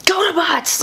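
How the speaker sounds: high-pitched villain call; mock yell. Sledge calling his minions by the wrong name, mock yell. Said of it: "he completely yelled the wrong thing".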